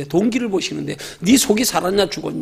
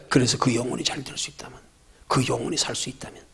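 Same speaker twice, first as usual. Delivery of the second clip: lower, hushed whisper; 6 semitones lower, 8 dB quieter than usual. Real, not acted. Preacher whispering about a saved soul. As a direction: breathe it out as a secret hope.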